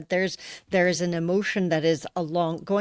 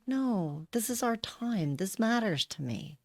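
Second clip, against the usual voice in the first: soft, quiet self-reminder; quietly firm; 2 semitones higher, 8 dB quieter than usual. Retold or imitated. Woman retelling her morning thought about their time together, quietly firm. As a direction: stand firm in a lowered voice. Retold inner reply, standing firm.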